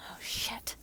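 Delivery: under-breath curse, barely voiced; whispered curse. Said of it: "you whisper under your breath".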